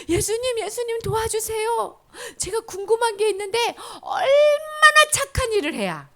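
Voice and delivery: high, loud, pleading voice; eager young man. Acted. Young man calling out eagerly to Jesus for help.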